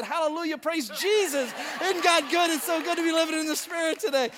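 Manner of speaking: suddenly mirror her churchy gush; instantly gushing pastor. Retold or imitated. Pastor copying Sue's gush once money is offered, high and loud. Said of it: "I said".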